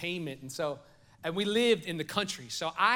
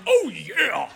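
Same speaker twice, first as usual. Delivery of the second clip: louder, cocky wrestler boast; mock bravado, pushed louder; 4 semitones higher, 9 dB louder than usual. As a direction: strut it like a grown man playing wrestler. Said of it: "he's going".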